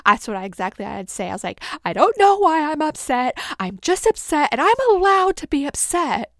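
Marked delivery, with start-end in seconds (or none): 1.8-6.3 louder, higher, insistent re-enactment; upset protest. Retold or imitated. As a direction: act out defending your right to be upset. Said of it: "I just knew I was upset".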